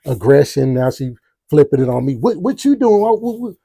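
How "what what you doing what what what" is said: higher-pitched imitation of a defensive woman; mock attitude. Girlfriend turning it on him at the door, mock attitude. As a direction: act out her flipping it on him, voice raised in pitch. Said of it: "now she got the attitude".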